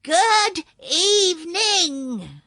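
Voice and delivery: very loud, high, slow drawn-out shout; loud high good evening, each word stretched; yelled greeting. Acted. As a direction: bellow good evening into a deaf man's ear. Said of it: "she yelled as loudly as she could".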